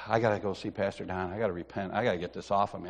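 low, soft, burdened voice; weighed down with remorse; quiet retold misery; guilty. Retelling his guilty resolve.

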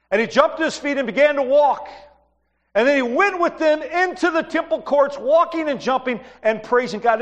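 preacher reading the healing verse aloud, rising and bright; scripture reading. Acted. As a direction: proclaim the verse to the congregation with lift. Reads the Bible verse aloud.

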